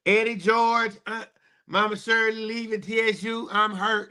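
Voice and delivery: high, hammed-up lament; mock hurt wail. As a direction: overact the heartbreak in a high voice. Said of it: "I'm just being sarcastic".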